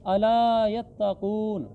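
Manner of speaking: अला यत्तकून recited slowly with long aa and oo; elongated vowels. Shown as a demonstration. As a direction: stretch the aa in अला. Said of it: "अला को भी खींचना है".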